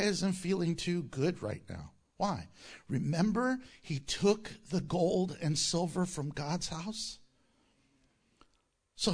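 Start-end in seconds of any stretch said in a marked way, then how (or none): none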